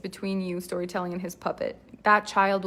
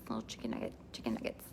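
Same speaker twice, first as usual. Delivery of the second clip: much lower, softer breathy whisper; whispered nugget; 13 semitones lower, 16 dB quieter, much faster than usual. Shown as a demonstration. Host murmurs the nugget phrase under her breath in a whisper. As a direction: breathe it out, hushed. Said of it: "Chicken nugget, all the things".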